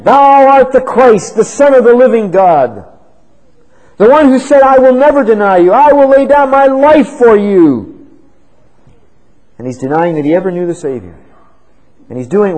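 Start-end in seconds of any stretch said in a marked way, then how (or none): 0.0-8.1 fervent preaching. Acted. Preacher to congregation voicing Peter's vow, fervent and loud. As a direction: proclaim it with full conviction.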